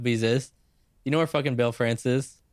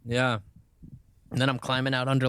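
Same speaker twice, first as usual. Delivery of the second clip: normal talking voice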